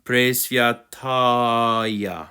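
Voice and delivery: "Presviataya" very slowly, long tah, final ya; drawn-out pronunciation. Shown as a demonstration. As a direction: stretch "Presviataya" out, ending on a clear ya. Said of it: "the last syllable is ya".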